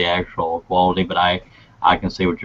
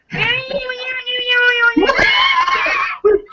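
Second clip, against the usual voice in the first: high shrieking laughter; can't stop laughing. Real, not acted. Host laughing hard at glitch.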